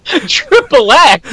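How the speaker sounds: slow, drawn-out incredulous question; can't believe what she just read; surprised. Real, not acted. Cohost hears eight-year-old's triple X, drawn-out disbelief. Reacting to kid's sign-off.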